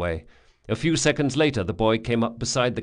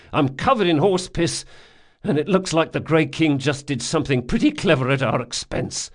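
aching sardonic complaint; 7 semitones higher, 5 dB louder than usual. Acted. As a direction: list your miseries, hurting. Locke listing his injuries.

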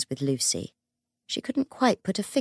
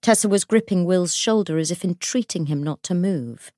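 plain reading voice

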